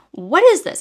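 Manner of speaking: high, loud, incredulous; outraged question. Real, not acted. Reviewer ranting about the hero, outraged high question. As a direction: demand it in disbelief.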